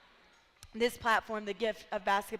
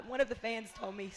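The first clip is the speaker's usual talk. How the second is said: usual speaking voice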